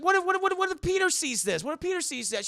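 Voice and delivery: high, stammering panic; panicked dad. Acted. Father fearing son sees the miniature, high panicked stammer. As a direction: spiral into a frantic worry. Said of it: "He's like".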